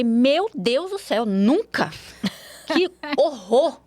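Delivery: slow, aghast cry of refusal; horrified shock. Retold or imitated. Daughter recalling her teen self's shocked refusal, aghast. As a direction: recoil in mock horror, drawing it out. Recoiling at imagined kiss.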